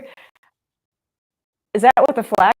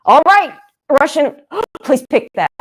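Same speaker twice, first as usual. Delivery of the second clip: excited pleading; 7 semitones higher, 6 dB louder than usual. Real, not acted. Urging a play during the game.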